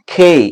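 খেই said with an aspirated kh; correct pronunciation. Shown as a demonstration. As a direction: say খেই with a breathy kh. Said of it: "এটার সঠিক উচ্চারণ হচ্ছে".

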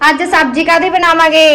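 high, loud dramatic question; dramatic loud aunty. Real, not acted. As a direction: call it out like a busy aunty.

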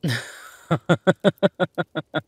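loud, high string of ha-has; bright peal of amused laughter; hearty laugh. Acted. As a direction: burst out laughing, then let it fall away. Amused by partner's unheard reply.

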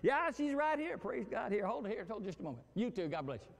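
high, bright church-phone politeness; sudden sweetness. Acted. Spouse answering the phone mid-fight, mock sweet. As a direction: switch instantly to sugary niceness.

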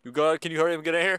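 ordinary talk

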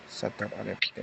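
very low, hushed prayer; murmured incantation. Real, not acted. Healer chanting over afflicted person, hushed incantation. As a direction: murmur the spell under your breath.

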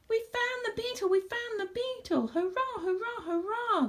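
high-pitched cheering; excited shouting. A narrator's reading. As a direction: cheer it out high, like a jubilant crowd. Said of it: "they shouted".

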